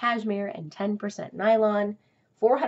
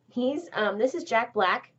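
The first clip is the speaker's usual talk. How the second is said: normal talking voice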